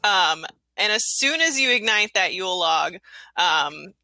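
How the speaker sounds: normal talking voice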